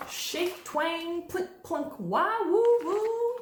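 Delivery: high instrument imitations in sequence; playful high-pitched band sounds; mock band noises. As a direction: voice each instrument, building to loud woos.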